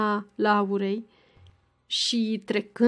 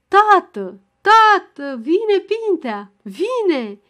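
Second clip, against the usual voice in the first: much higher and louder, slow on vine Pintea; excited shouting; 8 semitones higher, 7 dB louder, much slower than usual. A narrator's reading. Ghighi waking her father with the big news, shouting. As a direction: bang on the door and yell the news. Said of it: "tropăind și din picioare strigând".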